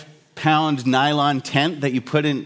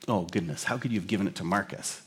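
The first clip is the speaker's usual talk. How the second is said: low, soft, hushed-down dismay; lightly voiced dismayed dad; 8 semitones lower, 11 dB quieter, faster than usual. Dad reproaching his son, lightly acted dismay. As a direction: lightly act a father's disappointed reproach. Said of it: "his dad says to Indy".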